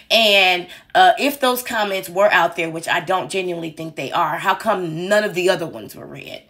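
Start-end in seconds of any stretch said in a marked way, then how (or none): none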